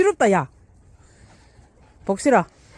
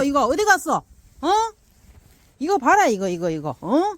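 ordinary talk, nothing marked

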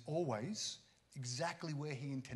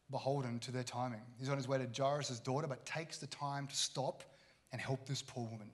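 usual speaking voice

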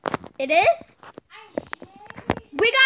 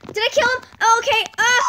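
usual speaking voice

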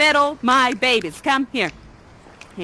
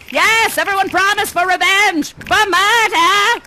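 hammed-up vow; 8 semitones higher, 5 dB louder than usual. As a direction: stretch the word murder out like a villain's vow. Vowing revenge with the kids.

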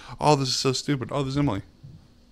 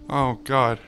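usual speaking voice

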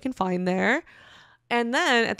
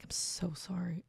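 embarrassed murmur; 3 semitones lower, 16 dB quieter, slower than usual. Retold or imitated. Apologizing to ex-boyfriend.

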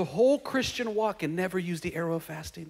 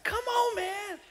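higher, exasperated; mild exasperated come on; 14 semitones higher, 5 dB quieter, much slower than usual. Retold or imitated. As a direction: say it in disbelief. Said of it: "It's like".

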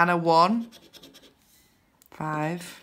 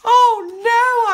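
high, loud, hammed-up protest; mock viewer shout; 9 dB louder than usual. Imagined viewers protest about unscratched wins, mock shout. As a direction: play the outraged viewer. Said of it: "I hear you shout".

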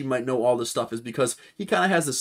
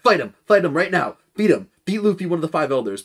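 louder, higher barked commands; mock shouted orders; 5 semitones higher, 6 dB louder than usual. Five elders ordering the admiral to attack, loud mock shouts. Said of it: "would have just been like".

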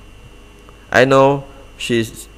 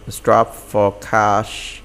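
"Strapped for cash" said very slowly, each word spaced out; slow model phrase; much slower than usual. Shown as a demonstration. Teacher slowly models "Strapped for cash" for the class.

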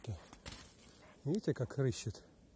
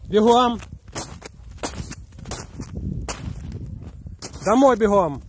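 loud, high commands into the wind; shouting over wind; 7 semitones higher, 8 dB louder than usual. Real, not acted. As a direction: yell so the dog hears through wind. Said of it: "Ветер задувает, не слышно".

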